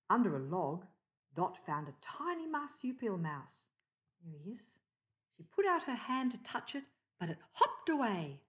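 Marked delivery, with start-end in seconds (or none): none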